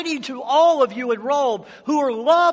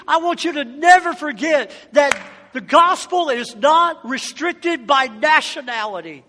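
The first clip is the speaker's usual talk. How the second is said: preach; 3 semitones higher, 5 dB louder than usual. Acted. Preaching at full force.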